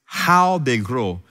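long, slow HOW; very slow, emphatic "how they grow"; stress on HOW. Shown as a demonstration. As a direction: hold "how" out to make it stand out. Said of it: "Notice the emphasis".

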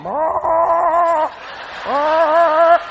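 long droning chicken "brr"; chicken noise. Retold or imitated. Other chickens watching the hatchet, nervous chicken noise. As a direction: imitate nervous hens with a drawn-out trill. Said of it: "Them other chickens going".

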